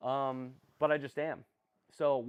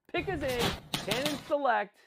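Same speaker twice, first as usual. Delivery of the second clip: excited pick call; 10 semitones higher, 4 dB louder than usual. Real, not acted. Announcing the draft pick live.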